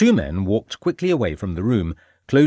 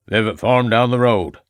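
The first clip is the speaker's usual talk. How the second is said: put-on louder rustic old man; old farmer; 5 dB louder than usual. A narrator's reading. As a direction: answer loudly as an old country farmer.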